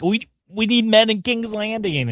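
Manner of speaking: a touch of a pleading lord, high and drawn out; Alan's voice. Retold or imitated. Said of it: "Alan's like".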